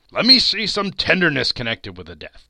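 loud, insistent appeal to the spirit; urgent plea. Acted. Scrooge begs the spirit to show some tenderness, urgent plea. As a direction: plead forcefully, raising the voice.

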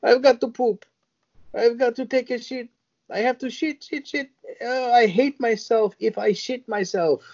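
hammed-up desperate woman, pitched high; high mock frantic woman. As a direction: wail it high and urgent. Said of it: "she goes".

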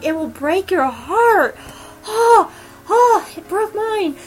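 high, squeaky mock sob; heartbroken whimper. Real, not acted. Heartbroken fan whimpering about scene.

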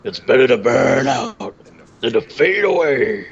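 slow, drawn-out villain impression; scenery-chewing villain. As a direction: chew the scenery like an over-the-top bad guy. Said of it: "he's chewing the scenery".

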